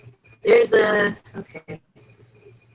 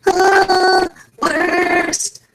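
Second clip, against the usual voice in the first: high, drawn-out mock despair; raised, stretched-out lament; exasperated groan; 8 semitones higher, 3 dB louder than usual. Real, not acted. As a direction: wail it in mock misery. Groans about the glitch.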